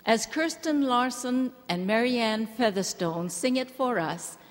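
normal talking voice

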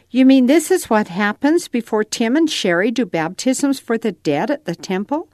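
put-on a young boy asking his mom, eager; boy Nathan asking. A narrator's reading. Reading to his mom, a lightly voiced curious boy.

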